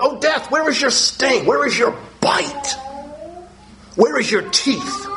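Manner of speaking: slow, rising dares thrown at death; taunting scripture quote. Acted.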